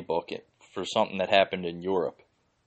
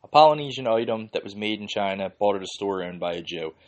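ordinary delivery

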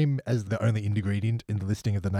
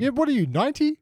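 high, louder teasing jab; mock incredulous; 14 semitones higher, 4 dB louder than usual. Real, not acted. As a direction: scoff at them playfully. Teasing friend about custard.

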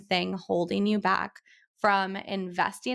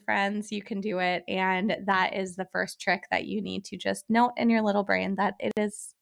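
normal talking voice

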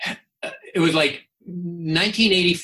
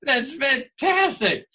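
delighted shout; 12 semitones higher than usual. Real, not acted. Host delighted by the prank.

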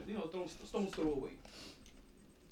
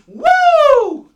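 hype woo; 25 dB louder than usual. Acted. Hyped show start.

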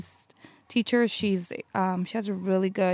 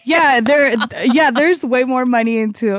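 excited; 4 semitones higher, 10 dB louder than usual. Real, not acted. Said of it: "Your eyes just got so big".

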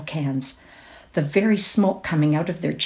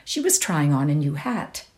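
usual speaking voice

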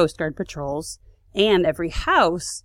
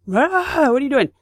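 mock angry outburst, higher and louder; enraged Dash; 8 semitones higher, 5 dB louder than usual. Saboteur snaps at his comrade, hammed-up anger. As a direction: snap at the man who spoke German. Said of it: "This enraged Dash".

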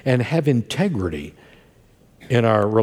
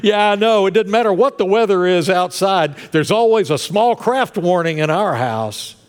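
higher and louder, cocky wisecrack; mock-smug joke; 9 semitones higher, 4 dB louder than usual. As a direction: deliver the punchline smugly to the guests. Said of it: "Mister Sensitive says".

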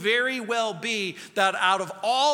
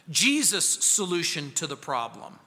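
usual speaking voice

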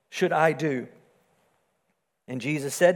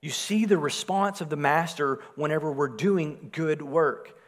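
normal talking voice

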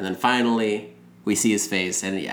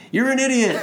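much higher, louder echo of You're an idiot; abyss yelling back; 6 dB louder than usual. Retold or imitated. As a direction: boom it back like a mocking echo. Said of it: "the abyss yells back".

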